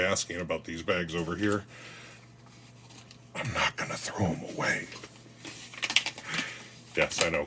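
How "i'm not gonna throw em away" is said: eerie breathy whisper; creepy voice. Pretend. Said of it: "Creepy voice, right?".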